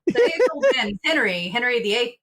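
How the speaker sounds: bursting with the joke; excited. Real, not acted. Contestant jokes about the Henry song, loud and excited.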